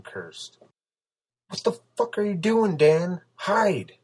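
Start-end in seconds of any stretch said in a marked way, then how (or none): 1.5-4.0 high, loud, frantic scolding; alarmed high-pitched telling-off. A narrator's reading. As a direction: scold him urgently, ending on a sharp order. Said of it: "Sarah scolded".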